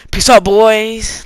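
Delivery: loud, high, drawn-out send-off; shouted sign-off. Real, not acted. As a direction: call it out long and loud to the viewers. Calling out the video sign-off.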